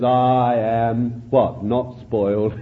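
a low, calm, assured answer; voice of the Lord. Retold or imitated. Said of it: "The Lord says".

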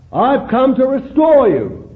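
high, loud declaration; the Lord proclaiming. Retold or imitated. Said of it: "he says".

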